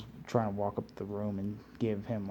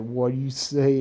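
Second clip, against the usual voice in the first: mildly raised, half-acted Naruto retort; lightly voiced annoyed Naruto; mock Naruto challenge; 3 semitones higher, 7 dB louder than usual. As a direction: toss it back like a cocky kid, lightly. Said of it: "He's like".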